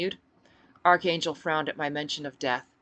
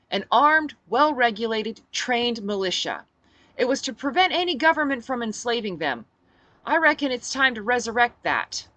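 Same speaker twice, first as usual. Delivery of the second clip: rousing speech; 7 semitones higher, 7 dB louder than usual. Acted. Rallying speech to crowd.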